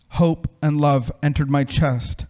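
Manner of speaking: ordinary delivery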